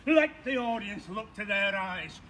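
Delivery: ordinary talk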